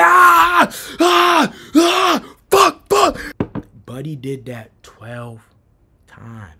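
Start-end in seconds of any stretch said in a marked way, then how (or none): 0.0-3.2 high, repeated cries of pain; reenacted pain yelps. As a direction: yelp as if struck by a stick. Reenacts being hit with stick.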